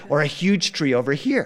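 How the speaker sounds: plain reading voice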